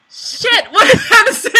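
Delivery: high, loud panic, laughing; panicked laughing shout. Pretend. Panic after forgetting lines.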